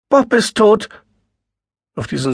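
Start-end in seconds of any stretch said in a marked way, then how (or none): 0.1-0.9 high, loud, breaking with shock; distraught. A narrator's reading. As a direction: choke out the terrible news. Sister announces husband's death.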